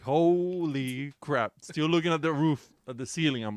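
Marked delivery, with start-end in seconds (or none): 0.0-1.5 slow, stretched holy crap; long, slow mutter of disbelief; drawn-out dismay. Retold or imitated. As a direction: drag each syllable out in dread. Retelling his dismay, drawn out.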